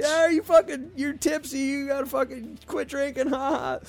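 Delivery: high, teasing, laughing jab; mocking drunk friend. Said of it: "We were laughing our asses off".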